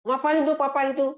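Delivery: put-on high whiny child complaining about parents; angry child. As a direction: act the kid sulking at being told off. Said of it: "itu kita malah marah".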